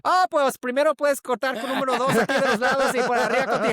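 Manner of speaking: high, loud, fast Spanish; rapid Spanish reply. Retold or imitated. Customer reveals Spanish to gossiping haircutters, brisk and high.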